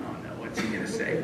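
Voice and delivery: soft whisper; whispered dread. Retold or imitated. Hearing Ron coming down the hallway, whispered. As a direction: hush it, afraid of what's coming.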